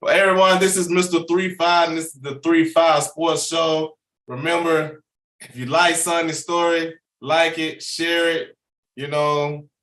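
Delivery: host wrapping up the show; podcast host signing off to viewers, upbeat; show outro. Acted. Host's outro sign-off.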